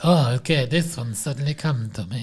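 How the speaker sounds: put-on thick Russian-style accent; mock Russian accent; weird Russian-sounding voice. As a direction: try out a silly Russian-sounding voice. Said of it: "Are you from Russia?".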